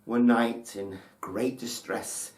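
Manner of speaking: ordinary delivery, nothing marked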